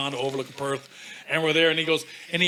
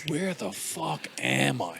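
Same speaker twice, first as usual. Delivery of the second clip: dazed mumble; 6 dB quieter, much slower than usual. Retold or imitated. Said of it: "And I was like".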